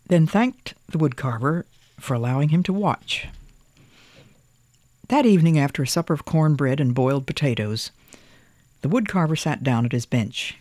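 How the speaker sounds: usual speaking voice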